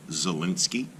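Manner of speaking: ordinary delivery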